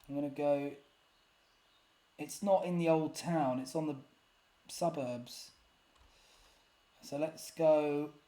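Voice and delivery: ordinary talk, nothing marked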